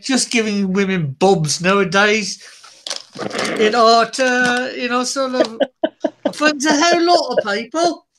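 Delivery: put-on a light, wobbly Irish accent drifting toward Scottish; mock Irish accent. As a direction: play it like a joking Irish storyteller. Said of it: "You sound like you're Irish".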